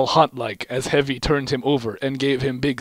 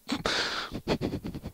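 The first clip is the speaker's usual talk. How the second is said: stifled laugh; 12 dB quieter than usual. Real, not acted. Reader breaks up laughing.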